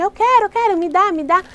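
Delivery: put-on high, pushy child begging for candy; high childish whine; whiny kid. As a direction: beg like an impatient little kid. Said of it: "só se vocês falarem assim".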